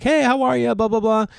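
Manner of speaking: high, breezy neighborly hello; mock friendly greeting. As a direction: chirp it, going through the pleasantries. Retold greeting to dog walkers.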